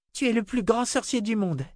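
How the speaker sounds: put-on very high, delighted little girl voice; exclaiming in wonder. A narrator's reading. Little girl marvels at the old wizard's candles, exclaiming. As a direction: exclaim it like an amazed child. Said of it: "s'exclama-t-elle".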